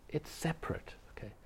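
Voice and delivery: breathy whispered aside. Real, not acted. Teacher whispering to students about separateness. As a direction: hush it to a whisper.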